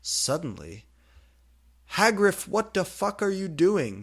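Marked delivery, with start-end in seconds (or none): 1.9-4.0 high, loud yell; shout. Acted. Draco yelling at Hagrid, shouting. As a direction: yell it across the room. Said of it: "he shooted".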